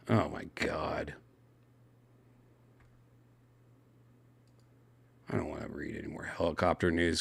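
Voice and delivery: weary whispered aside. Real, not acted. Tired of crash news, whispering to the viewers. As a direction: breathe it out, fed up.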